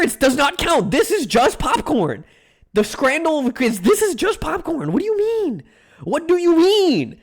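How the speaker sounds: high pitched scandalised whine; outraged. Real, not acted. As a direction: rant in mock outrage at the price. Outraged at overpriced popcorn.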